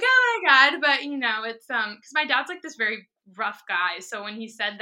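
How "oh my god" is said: high, loud laughing exclaim; laughing oh my god. Real, not acted. Telling the host about her dad's praise, higher and louder through laughter. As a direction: gush it out through a laugh, overwhelmed.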